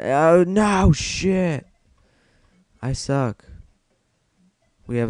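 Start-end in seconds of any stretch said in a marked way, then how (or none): 0.0-1.7 high, loud drawn-out cry of dismay; alarmed outburst. Real, not acted. Player to himself as the game goes wrong, alarmed outburst. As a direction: yelp it as the move goes wrong.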